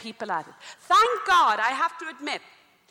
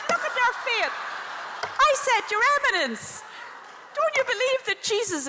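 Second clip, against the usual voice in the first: raised, higher challenge; indignant exclamation; 10 semitones higher than usual. Retold or imitated. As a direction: cry out in disbelief at the cardinal. She rebukes the cardinal.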